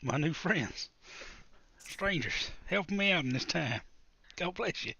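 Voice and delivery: a hint of a needy beggar; pleading character. Player begs new friends for help, lightly hammed-up plea.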